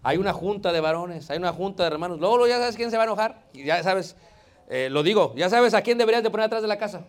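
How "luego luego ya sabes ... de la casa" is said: loud teasing. Acted. Teasing the angry brother.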